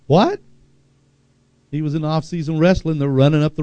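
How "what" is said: sudden loud high what; shocked. Retold or imitated. Father hearing his son was hit by a car, shocked. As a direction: jump at the news.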